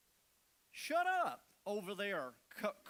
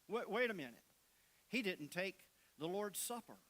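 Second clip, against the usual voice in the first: ordinary talk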